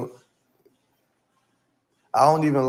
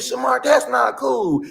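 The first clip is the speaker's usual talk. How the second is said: high, slow, drawn-out imitated reproach; dramatic high-pitched retold scolding; scolding woman; 12 semitones higher, slower than usual. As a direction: mimic her crying out in protest. Voicing the lady scolding Shamar.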